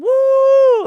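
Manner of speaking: very high, loud imitated crowd whoop; crowd cheering. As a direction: belt out a cheering "Woo!" like a whole crowd. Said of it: "everyone was just".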